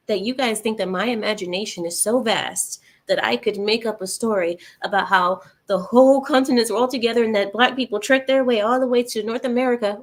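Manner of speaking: ordinary talk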